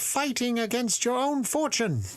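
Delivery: high, otherworldly spirit pleading; eerie high spirit voice. Acted. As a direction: voice the bottle spirit well above your own. Spirit speaks from the bottle.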